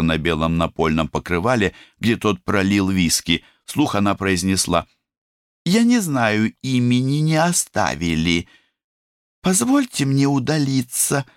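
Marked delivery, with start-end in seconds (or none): 5.6-11.2 put-on high, nervous young maid; a shy servant girl edging away; timid girl voice. The maid Biba answers.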